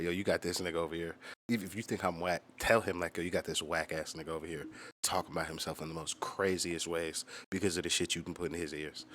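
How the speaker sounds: ordinary talk, nothing marked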